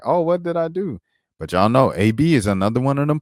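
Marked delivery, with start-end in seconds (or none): 0.0-1.0 mock innocence. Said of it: "she looking like".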